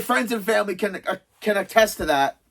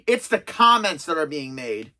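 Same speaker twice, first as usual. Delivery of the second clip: usual speaking voice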